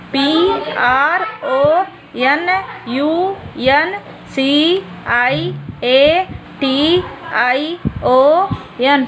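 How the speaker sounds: letters spaced far apart, very slow; slow spelling. Shown as a demonstration. Teacher spelling a long word for the class, very slowly. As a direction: dictate each letter so children can copy. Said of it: "pronunciation की spelling".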